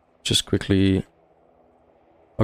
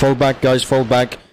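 shouted order; 5 semitones higher, 3 dB louder, slower than usual. Real, not acted. Ordering retreat under fire.

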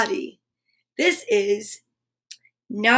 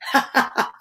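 short villainous chuckle, breath-led; nasty laugh; 2 semitones higher than usual. A narrator's reading. Gobbo springing his trap on Naughty, nasty laugh. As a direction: laugh nastily, in separate ha-ha bursts. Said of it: "with a nasty laugh".

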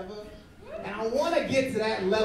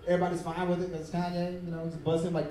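ordinary talk, nothing marked

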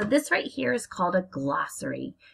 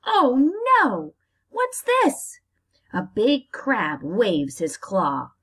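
dramatic story reading; 2 semitones higher, 4 dB louder, slower than usual. Acted. Teacher reading the crab fight to her class, dramatic. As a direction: make the crab fight exciting for the kids.

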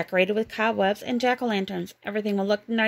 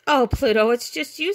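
lightly voiced Mickey, louder, relieved; a hint of relieved laughter; 4 semitones higher, 5 dB louder than usual. A narrator's reading. Mickey finds Pluto under the sheet, lightly relieved. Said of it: "said Mickey".

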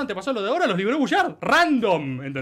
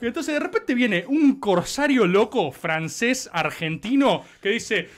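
ordinary talk

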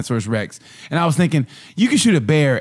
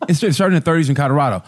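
usual speaking voice

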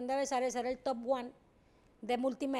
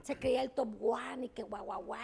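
ordinary delivery

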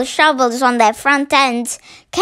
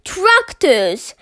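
higher-pitched mock shout; shouted alarm; 5 semitones higher than usual. A narrator's reading. Mr Fox warns his family of the machines, shouting. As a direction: call out the warning, pitched up. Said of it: "shouted Mister Fox".

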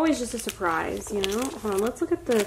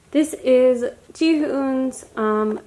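usual speaking voice

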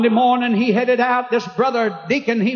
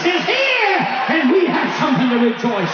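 preaching at full force; 5 semitones higher than usual. Real, not acted. Preacher calls the congregation to rejoice anywhere, full-force shout. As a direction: preach it to the rafters.

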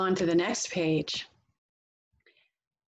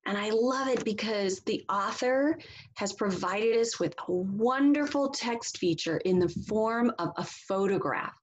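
ordinary delivery

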